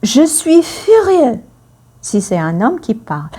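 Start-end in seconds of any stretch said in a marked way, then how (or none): 0.0-1.4 mock furious exclamation; acted fury. Shown as a demonstration. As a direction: spit it out as if furious. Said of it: "pour exprimer la colère".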